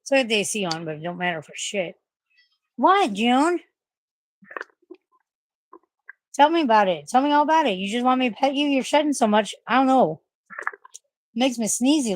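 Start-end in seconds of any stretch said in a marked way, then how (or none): 2.8-12.2 high, sing-song cooing voice. Real, not acted. Owner petting her shedding cat, high affectionate baby talk.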